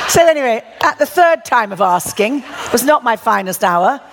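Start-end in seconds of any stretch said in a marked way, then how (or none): none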